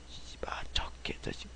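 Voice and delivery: very soft whispered swearing; muttered curse. Real, not acted. Game streamer privately curses someone, whispering under breath. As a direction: curse under your breath, barely audible.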